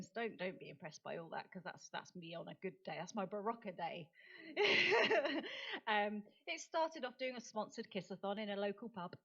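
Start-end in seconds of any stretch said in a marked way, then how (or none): 4.1-5.8 loud high laugh. Real, not acted. Audience moment, big laugh.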